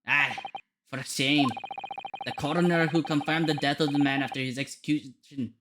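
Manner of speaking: put-on Scottish accent, solemn account; Scottish character. Naming who was in charge that day, Scottish accent. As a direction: narrate the disgrace as a Scot.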